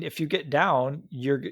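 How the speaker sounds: ordinary talk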